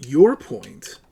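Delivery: Your punched loud; stress on your. Real, not acted. Debater pressing an opponent's claim, hammering Your. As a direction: hit Your hard and drop.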